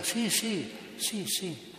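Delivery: high, eager repeated yes; a voiced Indian, eager. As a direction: agree like an eager convert. Indian eagerly agrees to baptism.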